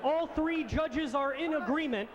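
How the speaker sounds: ordinary delivery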